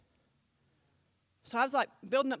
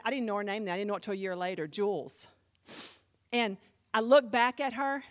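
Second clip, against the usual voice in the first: usual speaking voice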